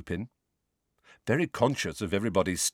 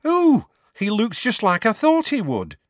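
put-on young witch voice; 13 semitones higher, 6 dB louder than usual. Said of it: "said the witch".

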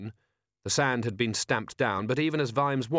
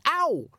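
sharp high ow; a quick pained yelp; 4 dB louder than usual. Acted. Boy cuffed again.

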